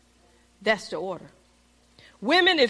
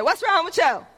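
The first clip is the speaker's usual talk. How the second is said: higher, louder mock scolding; 6 semitones higher, 4 dB louder than usual. Miss Brunson scolding her husband, higher and louder. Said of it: "Miss Brunson said".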